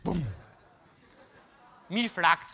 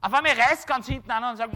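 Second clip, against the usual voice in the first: ordinary talk, nothing marked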